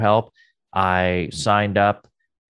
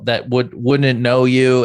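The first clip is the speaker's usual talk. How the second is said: normal talking voice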